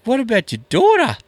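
high-pitched, incredulous retold question; hammed-up astonishment; mock disbelief. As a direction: act aghast, voice way up. Retelling his shocked reply.

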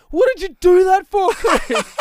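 high, loud, indignant yell; outraged shout. Retold or imitated. Friends yelling at Chris, an outraged high shout. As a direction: demand an answer, shouting high. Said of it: "everyone looked at me and said".

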